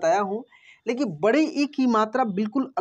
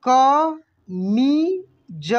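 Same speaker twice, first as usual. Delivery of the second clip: कमीज stretched very slowly, each syllable separate; 2 semitones higher, 3 dB louder, much slower than usual. Shown as a demonstration. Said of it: "कैसे पढ़ेंगे?". (कमीज को धीरे धीरे बोलिए)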